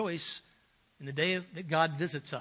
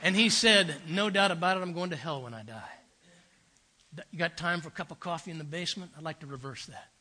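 put-on Southern accent; 4 semitones lower, 6 dB quieter than usual. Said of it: "marvelous Southern accent".

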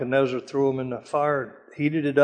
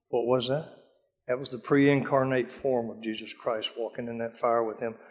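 normal talking voice

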